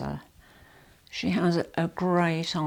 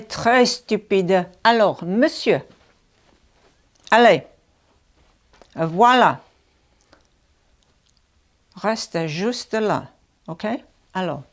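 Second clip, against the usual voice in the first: loud mock scolding in French; 2 semitones higher, 9 dB louder than usual. Real, not acted. Wife loudly scolding her husband in French, playful mock anger. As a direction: tease him hard in French.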